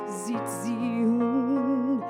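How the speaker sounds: plain reading voice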